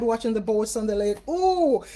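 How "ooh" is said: high, bright ooh; light up at the news; delighted ooh. Real, not acted. Hearing a viewer has a lake too, excited ooh.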